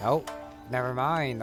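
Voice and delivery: normal talking voice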